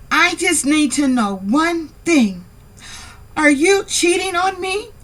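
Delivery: high-pitched, put-on demand for an answer; acted confrontation. Woman confronts partner about cheating, high-pitched mock demand. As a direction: raise the voice high and press the question.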